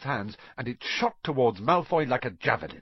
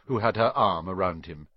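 plain reading voice

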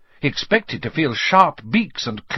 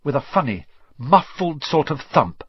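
ordinary delivery, nothing marked

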